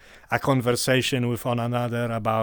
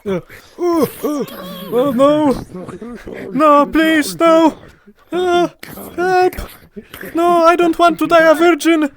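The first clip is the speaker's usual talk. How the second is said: panicked screams of a dying man; hit hard crying out; 10 dB louder, slower than usual. Acted. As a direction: scream for help as you get cut down. NPC dying in combat demo.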